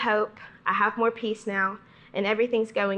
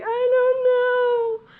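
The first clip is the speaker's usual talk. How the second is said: high, loud, slow crying wail; bawling; 6 dB louder, much slower than usual. Retold or imitated. To her camp leader, sobbing high, loud and slow. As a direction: sob it out, much higher and louder. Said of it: "I start bawling".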